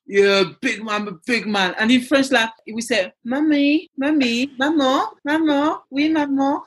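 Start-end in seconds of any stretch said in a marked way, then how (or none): 0.1-1.7 tough big man. Retold or imitated. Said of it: "this rough, big, he's a big man". 3.2-6.6 mother imitating her young son's cute French voice; cute little boy. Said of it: "And the voice is so, oh, it's so cute".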